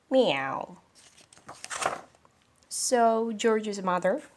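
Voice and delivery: ordinary delivery, nothing marked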